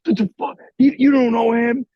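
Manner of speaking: high-pitched mock disbelief, imitating buddies; mock teasing. Buddies busting his balls after the snub, teasing. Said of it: "like, busting my balls".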